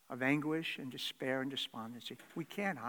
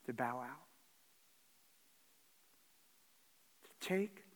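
hushed hopelessness. Retold or imitated. Despairing soul wants to quit.

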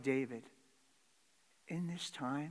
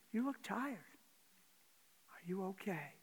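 gentle concern; 4 semitones higher, 6 dB quieter than usual. Retold or imitated. Concerned friend asks gently.

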